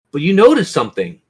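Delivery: ordinary delivery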